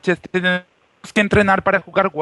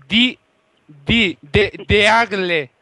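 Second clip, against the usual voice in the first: slow, careful spelling of "Agle"; slower than usual. Shown as a demonstration. Spelling his gamer name for the hotel receptionist, slowly. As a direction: draw out "de, de Agle" as if dictating letters. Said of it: "¿Me lo deletrea?".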